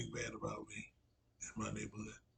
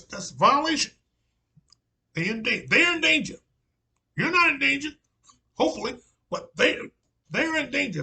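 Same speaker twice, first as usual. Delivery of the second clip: put-on the prophet quoted in a loud South Carolina accent; Southern Carolina accent; 14 semitones higher, 13 dB louder than usual. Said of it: "in that old South, Southern, uh, Carolina accent".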